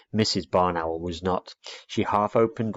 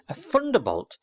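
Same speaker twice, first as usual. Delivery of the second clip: startled exclamation; 8 semitones higher than usual. Acted. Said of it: "cried the old lady".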